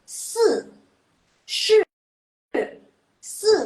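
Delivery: se, Shi, Se each hit high and loud, falling sharply; forceful fourth tones. Shown as a demonstration. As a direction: bark each syllable down like stepping on the gas. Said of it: "kayak ngegas gitu loh".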